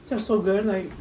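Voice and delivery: talking with a mouthful; words round a bite of food; mouth full. Real, not acted. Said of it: "I'm not even eating".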